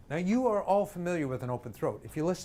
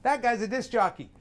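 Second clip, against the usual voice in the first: higher, closed-throat everyday voice; onlookers' remark; 6 semitones higher, 3 dB louder than usual. Acted. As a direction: point someone out in a pinched, higher voice. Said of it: "people would say".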